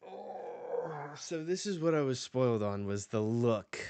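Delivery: sudden excitement. Real, not acted. Realizes the spoiler.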